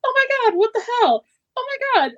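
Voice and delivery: high, laughing swings between joy and despair; mock emotional whiplash. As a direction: gush and panic in turns, half laughing. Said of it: "I would be like".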